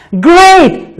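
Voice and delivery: high bright praise; teacher praise. Real, not acted. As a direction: enthusiastic classroom praise. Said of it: "praising child".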